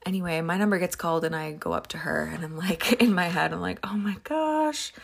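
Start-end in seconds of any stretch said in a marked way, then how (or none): 3.8-4.9 high, slow gasp of dread; a hammed-up inner panic, drawn out; dreading the scary clerk; mock dread.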